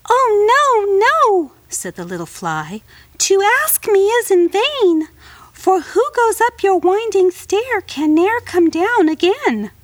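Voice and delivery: very high, frightened little fly; high tiny fly voice. Acted. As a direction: squeak it like a scared tiny insect. Fly voice in read poem.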